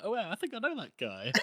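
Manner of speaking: high, lightly amused musing; oblivious. Acted. Man in the corner during an interrogation, comically oblivious. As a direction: act cluelessly self-absorbed. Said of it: "Just completely oblivious".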